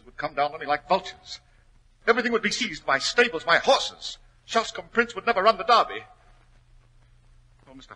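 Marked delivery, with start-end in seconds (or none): 2.1-6.0 high, loud desperate plea; spilling out his fear of ruin; rising panic. Acted. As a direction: debtor pleading to the detective. Desperate confession.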